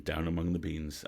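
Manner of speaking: usual speaking voice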